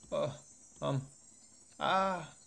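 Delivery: faltering, rising stammer; lost for words in fear; confused and losing calm. Acted. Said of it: "He sounds confused and he's completely losing his calm".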